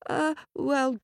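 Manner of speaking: lightly flustered hesitation; stuttering farmer. Acted. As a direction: falter for an answer. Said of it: "stuttered the farmer".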